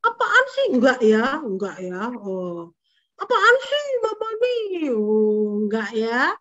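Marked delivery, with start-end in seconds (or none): 0.1-0.7 high, loud mock-bratty outburst; snapping at parents. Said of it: "Ada yang bentak-bentak papa mama?". 3.2-4.9 very high, loud bratty protest; acted child yelling back at mom; snapping at parents. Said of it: "Ada yang bentak-bentak papa mama?".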